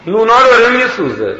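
calling out high and loud, خانوم twice; retold shout. Neighbour woman shouts to the wife from the kitchen, loud and high. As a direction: yell from the kitchen that the bread is burning. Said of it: "داد زد".

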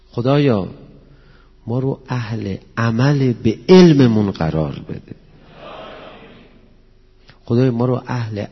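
loud, fervent prayer to God. Acted. Preacher leading the congregation in a loud, fervent prayer.

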